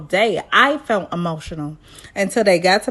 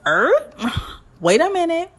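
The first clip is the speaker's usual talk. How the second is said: high startled "Wait a minute"; taken aback; 8 semitones higher than usual. Real, not acted. Reacting to the Zach Junior question, startled. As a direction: stop short in disbelief.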